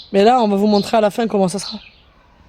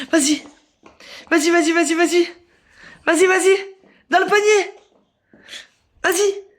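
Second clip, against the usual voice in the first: eager encouragement; 6 semitones higher than usual. Real, not acted. Urging child to shoot.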